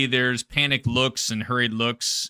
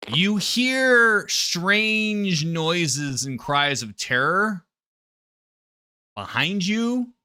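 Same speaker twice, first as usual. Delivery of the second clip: very slow, drawn-out higher delivery; ominous narration; 6 semitones higher, much slower than usual. A narrator's reading. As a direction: stretch out the words for suspense. GM builds suspense with slow narration.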